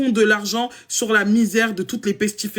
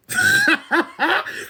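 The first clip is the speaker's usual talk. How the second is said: high-pitched burst of real laughter. Real, not acted. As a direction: cracks up in a sudden high laugh. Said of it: "mais là j'ai un fou rire". (éclat de fou rire aigu)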